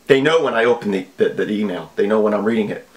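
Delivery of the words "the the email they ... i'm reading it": ordinary talk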